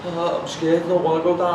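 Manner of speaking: high, whining imitation of a frightened girlfriend; put-on timid protest; mock scared.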